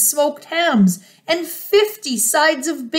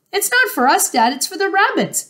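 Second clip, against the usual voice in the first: high-pitched, earnest youngster; small child fox; 7 semitones higher than usual. A narrator's reading. Smallest fox explaining to Dad, in a high child's voice.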